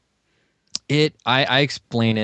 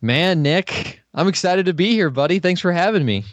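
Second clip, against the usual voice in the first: high, upbeat radio host voice; 10 semitones higher than usual. Acted. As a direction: greet him like a radio DJ. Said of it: "Get the radio voice going".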